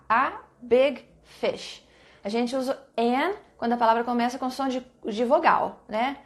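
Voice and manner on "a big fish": "A big fish" drawn out very slowly; slow model. Shown as a demonstration. Teacher modelling the right answer for students, slowly. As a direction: model the phrase slowly for the class. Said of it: "a gente tem que usar o a".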